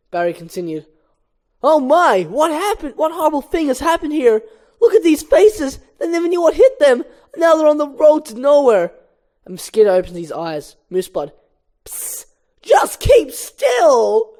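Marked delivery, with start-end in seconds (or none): 1.6-8.9 high, loud, hammed-up dismay; horrified exclaiming. Barry finds himself among dead bugs, horrified. 11.8-14.3 hushed psst, then high, loud, stretched-out warning; high-pitched comedian voice, dragging the words; Chris Rock. Acted. Mosquito Moose Blood warns Barry, high comedian voice. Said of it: "I'm gonna do the Chris Rock voice".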